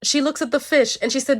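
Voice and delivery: ordinary delivery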